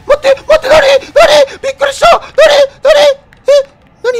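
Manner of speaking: terrified shriek; hit hard crying out. Real, not acted. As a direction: scream high and loud in fright. Startled by something in game.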